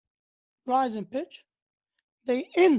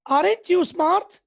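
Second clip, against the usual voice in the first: slow, raised, loud sarcasm; mock praise, pitched up and loud; sarcastic; 5 semitones higher, 6 dB louder than usual. Shown as a demonstration. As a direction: say it as a drawn-out, sarcastic jab.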